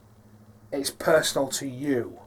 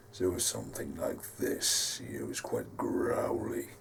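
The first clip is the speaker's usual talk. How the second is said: very low, soft, growly villain; Dark Roman; 12 semitones lower, 10 dB quieter than usual. Shown as a demonstration. Creator demonstrating Dark Roman's voice, deep hushed growl. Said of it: "has a deep voice".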